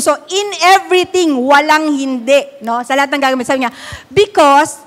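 usual speaking voice

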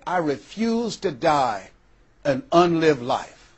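slow, deliberate "I refuse to die an unlived life"; slow resolve. Retold or imitated. Dying woman at her kitchen table vowing to live, slow. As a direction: weigh every word of "refuse" slowly.

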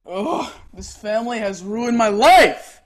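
loud, drawn-out melodramatic wail of complaint; dramatic outburst. Acted. Teenager complaining to mother about the family, loudly dramatic. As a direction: build to an overwrought, loud finish. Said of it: "Don't be so dramatic".

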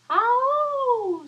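high, loud startled oh; a wolf caught off guard; surprised wolf. Acted. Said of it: "said the wolf".

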